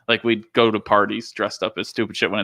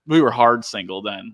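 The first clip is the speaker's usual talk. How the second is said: usual speaking voice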